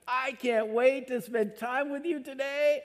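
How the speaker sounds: high, bright eager voice; excited, can't-wait welcome; eager God voice. Acted. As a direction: play God, thrilled to see you. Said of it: "sitting on the edge of his seat going".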